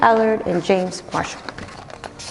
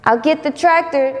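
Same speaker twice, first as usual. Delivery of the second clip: pa; 7 semitones higher than usual. Acted. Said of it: "says their pa".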